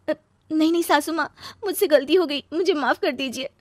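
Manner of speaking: high, loud, tearful pleading; high-pitched, frantic begging; desperate apology. Acted. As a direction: beg forgiveness of mother-in-law. Begs mother-in-law for forgiveness.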